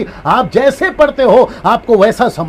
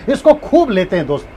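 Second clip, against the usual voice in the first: usual speaking voice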